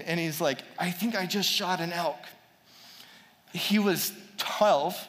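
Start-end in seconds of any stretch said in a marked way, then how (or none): none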